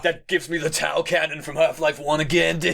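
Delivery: laughing bro voice; jock voice. Pretend. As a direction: tell it like an excited gym bro. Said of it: "brings out your jock voice".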